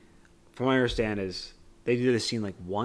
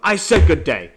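much higher and louder mock shout; loud catchphrase; 8 semitones higher, 15 dB louder than usual. Shown as a demonstration. Showing a friend how she shouts the catchphrase, higher and louder. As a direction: blast the catchphrase like Wonka. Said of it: "which I say all the time".

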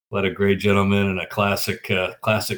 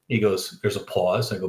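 ordinary delivery

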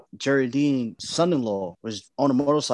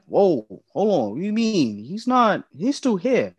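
higher-pitched, stunned refusal to believe it; retold disbelief; 7 semitones higher than usual. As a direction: retell the shock of refusing bad news, pitched up. Retold disbelief at death news.

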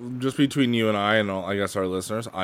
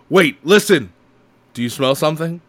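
higher and louder, quoting a spooked movie line; Ghostbusters quote; 6 semitones higher, 7 dB louder than usual. Retold or imitated. Quoting the Ghostbusters library scene to a friend, louder and higher. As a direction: act out the tense library moment. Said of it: "that one scene in Ghostbusters".

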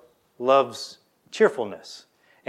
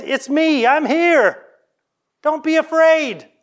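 much higher and louder, calling out reassurance; voiced Jesus, urgent comfort; 11 semitones higher, 6 dB louder than usual. Jesus calling to his scared disciples, loud reassuring cry. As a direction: cry out across the water to calm them.

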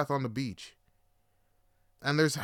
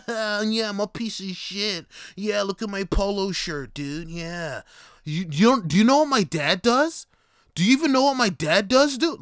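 put-on very loud, high, whiny rich-kid bragging; mocking entitled bro; 7 semitones higher, 7 dB louder than usual. Mocking a polo-shirt bro bragging about his dad, loud high whine. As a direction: shout it like a spoiled kid flexing his dad.

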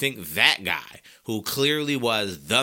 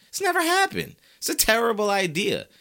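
much higher exclamation; high incredulous outburst; 11 semitones higher, 3 dB louder than usual. Real, not acted. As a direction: fling it up in disbelief. Fired-up rant.

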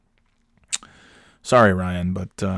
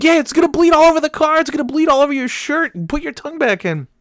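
imitated scolding wife; 5 dB louder, faster than usual. Wife dismissing husband's worry about blood, exasperated mock scolding. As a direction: rattle off a wife's sarcastic scolding.

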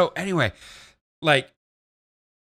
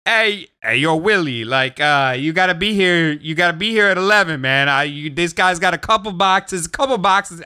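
higher, hammed-up New York Italian accent; Italian New Yorker; 4 semitones higher, 3 dB louder than usual. Buyer phoning Willie about a small job, Italian New Yorker accent. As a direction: hustle someone in a caricatured Italian New York voice. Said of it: "Italian New Yorker caricature".

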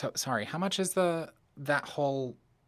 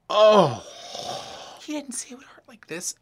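pained shock; 8 semitones higher, 12 dB louder than usual. Acted. Pain from the drug hits.

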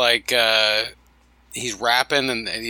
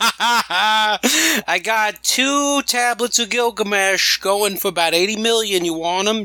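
mock dodgy antiques dealer pitch; shady dealer; 9 semitones higher, slower than usual. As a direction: hawk the stolen goods like a shifty fence. Fake shady dealer selling tablets.

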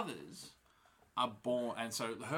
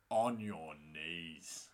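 very slow, low command; 6 semitones lower, much slower than usual. Retold or imitated. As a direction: lay down the order slowly. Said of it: "Moraine just comes in with the".

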